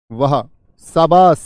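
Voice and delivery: ज़ोर से, धीमे खींचकर "वहा, शाबाश"; बच्चे की खुलकर तारीफ़ करते हुए; ज़ोरदार शाबाशी. Shown as a demonstration. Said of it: "खुशी का भाव". (खुशी से ज़ोर से खींचकर बोलें)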